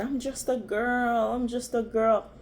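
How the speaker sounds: ordinary talk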